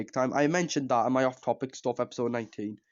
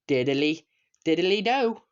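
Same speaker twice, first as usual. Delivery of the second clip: Ned Flanders; 3 semitones higher, slower than usual. Retold or imitated. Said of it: "Ned going".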